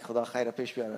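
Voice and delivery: soft, low prayer under the breath; worried mutter. Retold or imitated. As the man approaches, a soft muttered prayer. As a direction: murmur it quietly to yourself. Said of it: "and I'm like".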